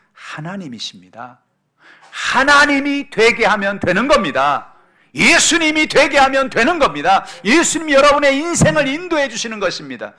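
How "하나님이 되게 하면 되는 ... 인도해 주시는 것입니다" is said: very loud, high proclamation; full-force preaching. Real, not acted. Preacher assuring congregation that God makes it happen, full-force preaching. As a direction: proclaim it at the top of the voice.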